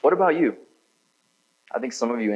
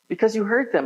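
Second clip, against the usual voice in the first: mom's reply; 13 semitones higher than usual. Retold or imitated. Mom's answer voiced.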